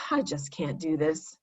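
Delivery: a quiet, sighing give-up. A narrator's reading. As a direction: sigh it out, giving up.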